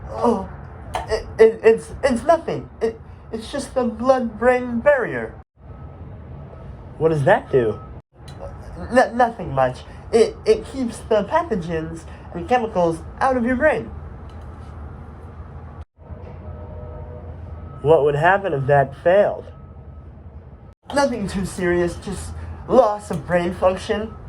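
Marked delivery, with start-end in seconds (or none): 0.1-5.3 high, stammering little voice; timid Astrocyte, flustered. Acted. Astrocyte plays down the barrier. 8.9-24.1 high, halting, meek voice; timid Astrocyte, playing it down. Astrocyte plays down the barrier.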